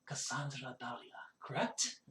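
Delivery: hushed, very soft dwarf's question; whispering. Acted. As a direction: lean in and whisper it discreetly. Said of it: "And he kinda whispers".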